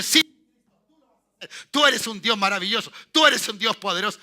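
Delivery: loud, fervent praise to God. Acted. Preacher voicing a believer praising Christ, loud and fervent. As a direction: cry it out, praising.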